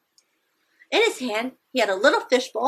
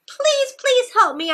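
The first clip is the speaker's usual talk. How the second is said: much higher, pleading voice; frantic child's plea; 14 semitones higher, 3 dB louder than usual. A narrator's reading. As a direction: beg for help like a scared kid. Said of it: "I said".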